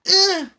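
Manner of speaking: very high, loud strained cry of effort; high strained grunt. Retold or imitated. Mimicking the chest light blinking, high loud strained grunt. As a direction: yelp like you're being squeezed. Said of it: "it feels like".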